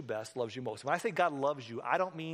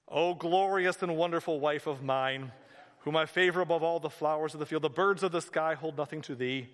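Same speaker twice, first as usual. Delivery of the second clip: grandiose love recitation; 2 semitones higher, 5 dB louder than usual. Acted. Husband reading a prepared speech to his wife, grandiose recitation. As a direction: intone it like stiff, archaic verse.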